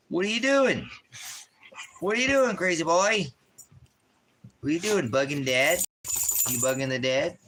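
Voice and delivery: put-on high, loud baby talk; baby talk to dog.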